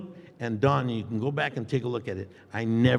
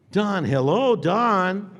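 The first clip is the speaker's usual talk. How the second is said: louder, higher call of his name; exasperated call; 8 semitones higher, 7 dB louder, much slower than usual. Retold or imitated. Actor calling to his co-star, exasperated and loud. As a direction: call out to him in disbelief.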